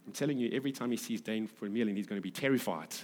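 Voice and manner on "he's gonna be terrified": ordinary talk, nothing marked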